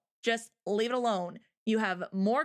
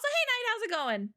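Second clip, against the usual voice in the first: ordinary talk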